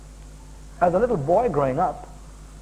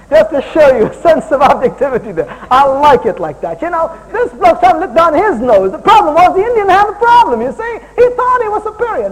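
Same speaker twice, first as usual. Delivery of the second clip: high and loud, hammering the point; preaching at full force; 12 semitones higher, 12 dB louder than usual. Acted. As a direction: preach it hard to the crowd.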